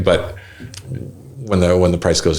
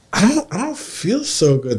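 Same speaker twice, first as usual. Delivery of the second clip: sick whimper; 10 semitones higher, 3 dB quieter than usual. Retold or imitated. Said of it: "I'm like".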